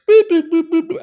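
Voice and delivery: high, loud phone-key boops; imitated dialling beeps. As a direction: mimic punching in a number with beeps. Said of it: "And I'm just like".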